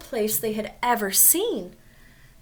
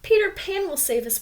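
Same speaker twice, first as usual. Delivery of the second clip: high, firm, lifted voice of courage; brave Wendy; 8 semitones higher, 3 dB louder than usual. Acted. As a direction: say it bravely to the captor. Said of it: "Wendy said bravely".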